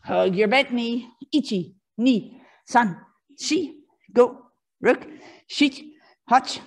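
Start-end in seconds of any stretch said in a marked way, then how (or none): none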